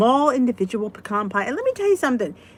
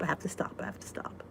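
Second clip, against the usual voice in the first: whispered, softer and softer; fading whisper; 6 semitones lower, 17 dB quieter, faster than usual. Real, not acted. Whispering a reminder to herself, fading away. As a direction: whisper it to yourself until it fades. Repeated under her breath.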